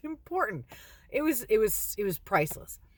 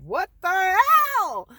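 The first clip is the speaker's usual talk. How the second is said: high, drawn-out disbelief; hammed-up incredulous outcry; mock shocked exclamation; 10 semitones higher, 8 dB louder, slower than usual. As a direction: blurt it out as if startled at yourself. Retold shock at own feelings.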